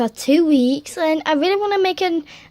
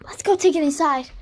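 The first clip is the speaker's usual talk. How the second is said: ordinary talk, nothing marked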